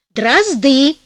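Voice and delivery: упрямый муж, слово с нажимом; настаивай, нажимая на слово; стоит на своём. A narrator's reading. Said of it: "стоял на своём муж". (упрямо)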